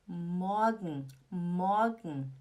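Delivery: MORGEN as a long "MAW-gn", R melted away; slow, low "morgen" without R; light A sound. Shown as a demonstration. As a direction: swallow the end of morgen. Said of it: "Tem um leve som de A".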